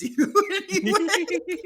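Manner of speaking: breaking into a laugh while talking. Real, not acted. Podcast host finishing a satirical scenario, cracking up. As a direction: let the punchline dissolve into laughter.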